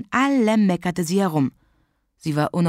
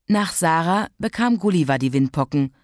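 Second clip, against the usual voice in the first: usual speaking voice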